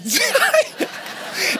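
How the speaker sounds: a high, rising laugh; mocking laugh. Athletes laugh at the scrawny coach, high and mocking. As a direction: laugh at the little guy.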